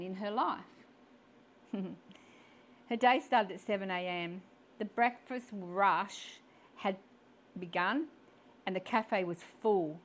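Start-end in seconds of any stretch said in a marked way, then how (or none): none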